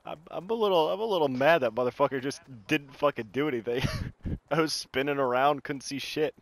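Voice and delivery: ordinary talk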